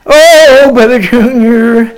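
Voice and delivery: ordinary talk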